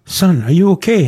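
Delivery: high, loud acted question; retold burst of startled concern; startled parent. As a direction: voice an astonished parent checking on a child. Startled parents address their waking son.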